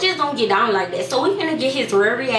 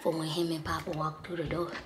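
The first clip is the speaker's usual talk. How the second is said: soft, low conspiratorial voice; hushed secret aside; 7 semitones lower, 12 dB quieter than usual. Real, not acted. Prankster explaining fake tears to viewers, hushed. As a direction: keep it very low, almost whispered.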